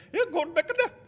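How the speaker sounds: high, loud mimicry through laughter; imitated local man. Mimicking local man's directions again.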